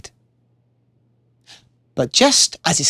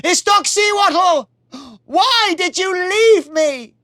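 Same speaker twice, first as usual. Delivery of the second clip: much higher, slow grieving call; yelling to the sky. Acted. Warrior calling his dead love to the sky, yelling. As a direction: call up to the heavens. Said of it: "he yelled up to the sky".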